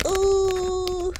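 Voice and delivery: surprised ooh. Real, not acted. Reacting to a card.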